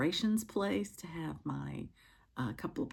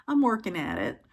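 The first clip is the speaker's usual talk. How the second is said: ordinary talk, nothing marked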